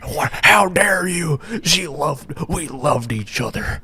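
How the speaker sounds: high, put-on indignant wail; hammed-up wounded lover's protest; mock heartbroken lover. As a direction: play it high and melodramatically betrayed. Acting a user mourning his bot.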